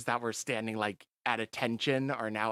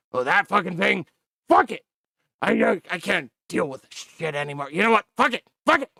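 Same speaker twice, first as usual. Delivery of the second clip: loud, higher raging shout; furious commander; 8 semitones higher, 13 dB louder, faster than usual. Acted. Commander raging at the major over the radio. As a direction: explode in fed-up rage.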